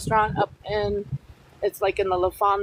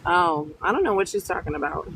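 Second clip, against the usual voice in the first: usual speaking voice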